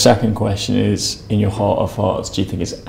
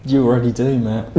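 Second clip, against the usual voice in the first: ordinary talk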